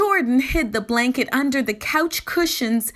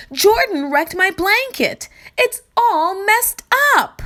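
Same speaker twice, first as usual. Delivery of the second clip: put-on upset child; 7 semitones higher, 4 dB louder than usual. A narrator's reading. Upset child complains to mother.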